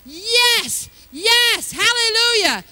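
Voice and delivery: high, loud praise burst; joyful shout. Real, not acted. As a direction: shout it out in real celebration. Celebrating the reduced bill.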